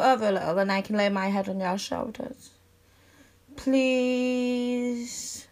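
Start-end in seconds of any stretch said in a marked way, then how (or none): 3.5-5.4 long drawn-out, wheedling please; adorable pleading. Acted. Begging a best friend for a cuddle, adorably pleading. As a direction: stretch it out into a cutesy plea. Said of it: "my adorable pleases".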